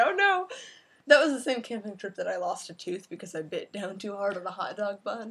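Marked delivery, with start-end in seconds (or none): 0.0-0.5 mock alarm. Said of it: "we'd say".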